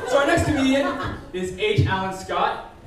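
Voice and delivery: ordinary talk, nothing marked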